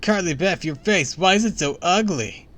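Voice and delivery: loud, high, aghast shout; horrified cry. A narrator's reading. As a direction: shout it as if horrified by what you see. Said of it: "he cried".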